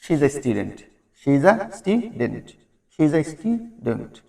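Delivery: She is a student said slowly, a short and weak; teacher slowly repeating an example sentence; slow demonstration. Shown as a demonstration. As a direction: model the sentence slowly for learners. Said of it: "like আমি কিভাবে বললাম এখানে".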